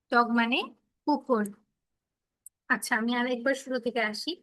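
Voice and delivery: ordinary delivery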